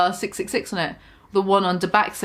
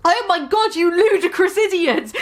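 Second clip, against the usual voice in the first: mock angry shout; 7 semitones higher than usual. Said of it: "They both shouted angrily".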